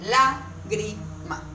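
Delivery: LÁGRIMA broken into syllables, slowly; slow teacher's syllable-by-syllable "Lágrima"; split into syllables. Shown as a demonstration. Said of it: "¿Cómo separamos?".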